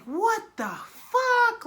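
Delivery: high, slow, stretched-out what the fuck; loud stunned disbelief; shocked outburst. Retold or imitated. Shocked at girl in bed.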